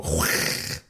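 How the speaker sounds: unvoiced-sounding rasp in the throat; wordless throat noise. Shown as a demonstration. Showing what the voice can do, raspy throat noise. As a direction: scrape out a harsh breathy duck-like noise. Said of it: "Like, I can go".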